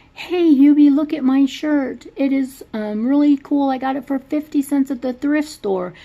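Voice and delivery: chirpy hammed-up mother showing off her shirt; mom voice. Retelling the movie, mom shows Hubie her new shirt in a high, loud mock voice.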